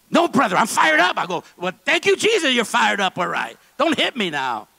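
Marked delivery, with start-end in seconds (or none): 0.1-1.2 much higher, louder burst of excitement; fired-up insistence. Retold or imitated. Pastor Joe telling the visiting pastor, loudly fired up. 1.6-4.7 higher, louder playful praise; excited laughing reply. Retold or imitated. Visiting pastor answering fired-up Pastor Joe, loud and playful.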